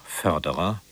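normal talking voice

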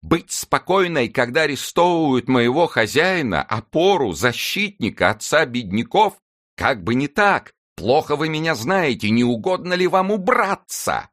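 put-on high, shrill-pitched outraged old woman; shrieking servant. A narrator's reading. Old servant Nanette screaming at the officer, outraged high yell. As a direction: scream it out in shocked indignation. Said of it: "закричала она Каменжу".

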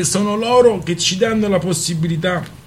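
earnest rally speaker finishing the phrase; pompous pathetic tone. Acted. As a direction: proclaim it with grand pathos. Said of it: "imitando il tono patetico di Svoboda".

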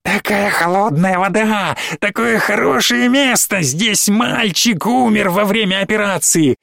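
high, sing-song gloating monster voice; gleeful monster. Acted. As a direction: relish every death like a giddy demon. Чудовище ликует о смерти. (чудовище на столе злорадно ликует, высоко)

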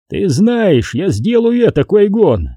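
high, challenging villain voice; defiant villain. A narrator's reading. Xanatos to Qui-Gon with blade at hostage's neck, defiant. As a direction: dare him with pitch held high. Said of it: "Его глаза сверкали вызовом".

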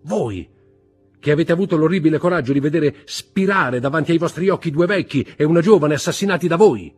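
loud, high tirade full of scorn; contemptuous shouting. A narrator's reading. Husband accuses his wife of murders, shouting scornfully. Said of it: "gridò Villefort con accento di disprezzo".

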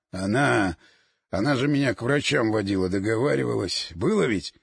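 low weak man. Acted. Oleg pleads weakly.

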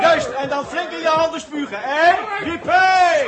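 high, loud whoop; gleeful battle cry. Acted. Jaap egging on the defenders at the window, whooping. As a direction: cheer the fighters on with a jippie.

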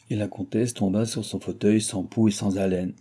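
ordinary delivery, nothing marked

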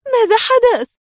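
startled awake and scared; frightened question. A narrator's reading. The wife, startled by her husband jumping up, frightened. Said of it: "زوجته تسأله مذعورة".

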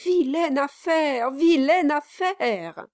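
high, drawn-out lament; dismayed exclamation. A narrator's reading. Brass deplores Kit's claim about the money, dismayed exclamation. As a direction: deplore it, stretching the repeat. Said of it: "s'écria Brass en secouant la tête".

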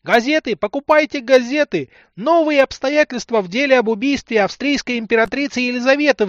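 high, loud street newsboy cry; newsboy hawking. A narrator's reading. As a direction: shout the headlines like a paperboy. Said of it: "вновь пронзительно загласил".

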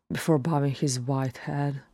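plain reading voice

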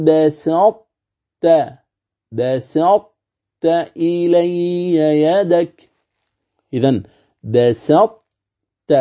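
بسطت slowly chanted, heavy doubled ta; Quran recitation demo. Shown as a demonstration. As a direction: recite بسطت slow, elevated ta. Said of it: "كذلك".

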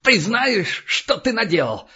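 high-pitched, outraged accusation; furious shouting. A narrator's reading. Freed captive accusing his captor of killing hope, acted furious cry. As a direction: splutter it out high, dragging key words. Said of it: "брызгая слюной, закричал".

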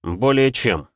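ordinary delivery, nothing marked

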